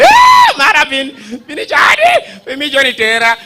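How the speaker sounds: high shouted alarm in Lao, breaking into laughs; yelling car alarm. Pretend. Car alarm yelling at the criminal in Lao. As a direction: yell it like a car alarm calling out a thief in Lao. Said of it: "the car starts yelling".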